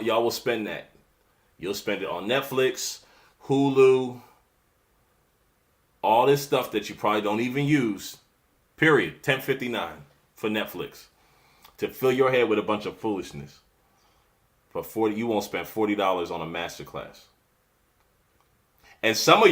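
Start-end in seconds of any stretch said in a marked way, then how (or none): none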